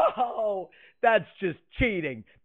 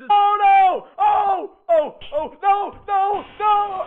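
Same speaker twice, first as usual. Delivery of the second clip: scared horror game streamer; 9 semitones higher, 4 dB louder, much slower than usual. Real, not acted. Baldi catching him.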